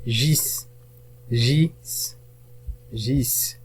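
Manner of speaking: "gis" softly set apart as a syllable; isolated syllable. Shown as a demonstration. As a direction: sound out "gis" alone, pausing after it. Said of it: "المقطع الثاني".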